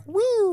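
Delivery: high sliding woo; falling woo. Retold or imitated. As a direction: whee as it drops. Imitating falling egg.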